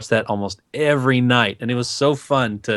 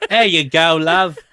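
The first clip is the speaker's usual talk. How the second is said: higher-pitched cheery Cockney imitation; put-on Cockney lilt; Cockney accent; 6 semitones higher, 4 dB louder, slower than usual. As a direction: play it as a chummy Cockney. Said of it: "a little more Cockney".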